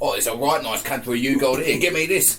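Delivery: loud, rising caricature of an Englishman; mock English accent. Guest plays English empire-builder taking a country, mock accent. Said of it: "you know those English".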